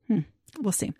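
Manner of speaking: ordinary delivery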